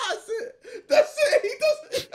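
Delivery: that's it squealed high through laughter; giggling high-pitched delight; high laughing. Real, not acted. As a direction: cackle as he pulls it off. Laughing at a video.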